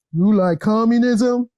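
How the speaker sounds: slow, mocking police officer questioning old women; cop voice. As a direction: drag it out like a taunting cop. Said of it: "that's her cop voice".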